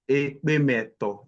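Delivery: Ekpe meto slowed, each syllable clear; slow repeat. Shown as a demonstration. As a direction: repeat it slowly for the learner.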